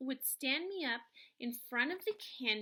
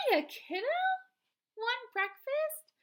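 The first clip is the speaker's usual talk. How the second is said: louder, much higher, slow cheerful grandma; beaming Grams; 13 semitones higher, 7 dB louder than usual. A narrator's reading. Grandma beaming at her grandson in the truck, louder and higher. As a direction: beam at the kid like an excited old grandma.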